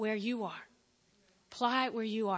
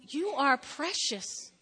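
God's loving voice; 5 semitones higher, 5 dB louder, slower than usual. Acted. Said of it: "God's going".

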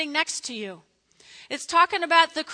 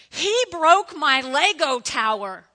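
put-on a slow, louder whine of a wronged child; whiny little kid; 2 semitones higher, 8 dB louder, slower than usual. Little kid whining to parent about a brother. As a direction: complain like a kid whose tower got knocked over.

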